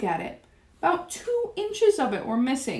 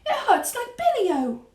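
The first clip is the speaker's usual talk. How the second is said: slow, high little mouse voice; whispered back; 12 semitones higher than usual. A narrator's reading. Mouse complains to Grandmama of his hurt tail, whispering back. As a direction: squeak it slowly, in pain. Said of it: "I whispered back".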